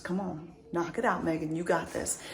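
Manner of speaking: quiet little push to yourself; self pep talk. Acted. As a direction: softly urge yourself on. Quietly pep-talking herself.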